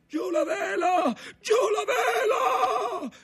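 high, long-drawn urgent shout; shouted command. A narrator's reading. Skipper to his crew in a squall, shouting. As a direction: yell it over the storm, stretching the last word. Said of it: "gridò padron Ntoni".

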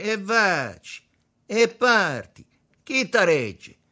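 high, loud, slow dare; getting sour. A narrator's reading. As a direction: goad him loudly, word by word. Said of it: "cominciò a prendere un po' d'aceto". (sfida inacidita e gridata)

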